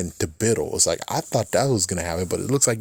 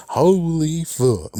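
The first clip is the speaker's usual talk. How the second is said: stunned; 7 semitones higher, much slower than usual. Real, not acted. Reacting to the transformation.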